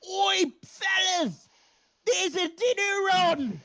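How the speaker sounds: high, loud cockney rat call; rat shouting. Acted. Rat Radicus calling his fellas, loud high shout. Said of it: "you hear him shout".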